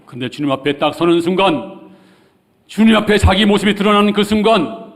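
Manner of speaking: high, loud full-force sermon delivery; urgent preaching. Real, not acted. Preacher to congregation on salvation today, urgent preaching. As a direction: proclaim it urgently at the top of your voice.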